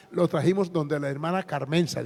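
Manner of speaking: ordinary talk, nothing marked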